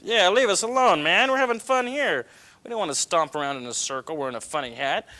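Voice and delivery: high, loud carefree elephant voice; high goofy cartoon voice. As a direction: play a cheeky elephant who wants to be left alone.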